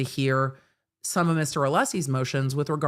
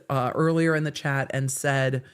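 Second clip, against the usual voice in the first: ordinary delivery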